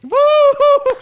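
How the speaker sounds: high, loud woo-hoo; excited whoop. Real, not acted. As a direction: let out a big whoop. Whooping at co-host's bold pick.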